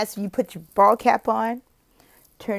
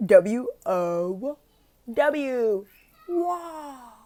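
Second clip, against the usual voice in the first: big wow; 10 semitones higher, much slower than usual. Shown as a demonstration. Teacher demonstrating the big wow cheer, high and fading. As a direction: stretch each wow big then fade. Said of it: "here's the".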